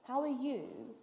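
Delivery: high, loud indignant disbelief; indignant outburst. Retold or imitated. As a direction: lay into him with outraged disbelief. Retelling her indignant reply to judge.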